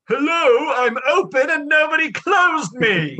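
high, loud, drawn-out comic British screech; Monty Python character. Acted. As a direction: shriek it like a sketch-comedy talking bag. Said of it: "sound like that character from a Monty Python".

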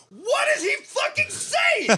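very high, drawn-out mock scream; exasperated retold shriek. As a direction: wail it in hammed-up exasperation. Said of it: "Casey goes".

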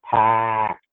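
"packed" with a long open A, no R; Boston A. Shown as a demonstration. Trying out the Boston accent, "packed" with long A. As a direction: stretch the A in "packed", drop the R. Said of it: "The A is the one that's emphasized".

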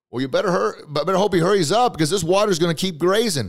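high, loud shouted warning; calling out. Acted. Helicopter rescuer warning man on roof, shouting. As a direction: holler the warning across a distance.

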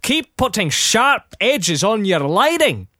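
mock-preach the lighting tip; mock advice. Retelling his advice to the CGI people, a hint of mock lecturing. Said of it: "I've been telling people".

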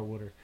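"water" said as "wooder"; extra "ood" in water. Shown as a demonstration. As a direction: put an "ood" in the middle of "water". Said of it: "there's extra little ood".

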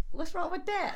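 laughing high and loud. Real, not acted. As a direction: blurt it out through laughter.

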